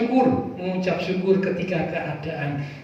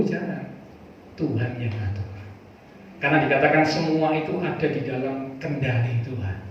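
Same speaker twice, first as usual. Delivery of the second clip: ordinary delivery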